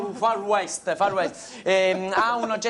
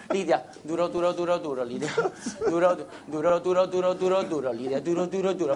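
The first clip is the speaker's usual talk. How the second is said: cantilena ipnotica; 4 semitones lower than usual. Acted. Incanta Lidia ripetendo. (cantilena ipnotica sommessa)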